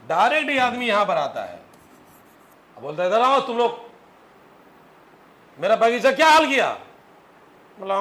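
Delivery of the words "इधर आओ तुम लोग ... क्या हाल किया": put-on furious yell at the kids, higher and louder; angry shout. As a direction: bellow like a man whose garden was wrecked. Angry garden owner scolds kids.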